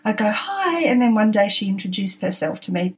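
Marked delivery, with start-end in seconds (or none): 0.3-0.8 chirpy hi. Retold or imitated. Cheery greeting to neighbour.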